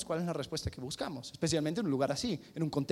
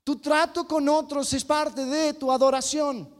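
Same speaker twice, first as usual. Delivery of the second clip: higher, louder, slow, punching "trato"; emphatic preaching; 9 semitones higher, 6 dB louder than usual. Real, not acted. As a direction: drive the point home at full force.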